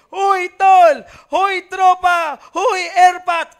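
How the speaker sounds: loud, high shout to buddies; pasigaw na tawag. Acted. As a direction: holler out drawn-out greetings to your pals. Calling out to friends at church. (malakas na pagtawag sa tropa)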